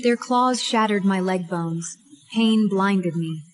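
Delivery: plain reading voice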